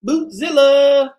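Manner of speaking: slow, loud, stretched call of Bootzilla; hollering the name long and high; big sing-song farewell holler; drawn-out shout. Real, not acted. Calling out a goodbye to viewer.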